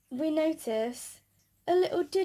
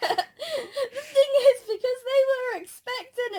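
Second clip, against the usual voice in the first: laughing; 9 semitones higher, 5 dB louder than usual. Real, not acted. Laughing as she starts the story.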